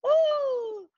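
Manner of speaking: hammed-up sweet old lady; Christmas Carol voice. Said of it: "this older woman's, like ... kind of voice".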